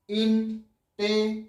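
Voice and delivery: Intelligent in four separate slow beats; slow syllable breakdown. Shown as a demonstration. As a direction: pause between ইন, টে, লি, gent in intelligent. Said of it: "আস্তে আস্তে উচ্চারণ করে বেরিয়ে যাবে". (Intelligent চার ভাগে ধীরে ধীরে)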